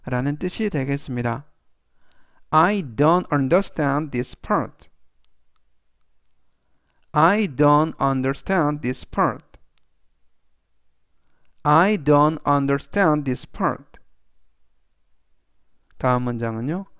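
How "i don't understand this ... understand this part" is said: very slow, word by word; slow read-aloud. Shown as a demonstration. Teacher reads the sentence slowly three times for students. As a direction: read it slowly so learners can follow. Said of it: "제가 역시 천천히 세 번씩 읽어드리고요".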